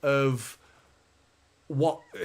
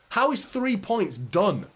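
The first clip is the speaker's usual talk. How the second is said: higher, drawn-out incredulous question; exasperated disbelief; 6 semitones higher, 3 dB louder, slower than usual. Real, not acted. Fan disputes that three points ends it, incredulous. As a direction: spell it out slowly in disbelief. Disputes that the title race is over.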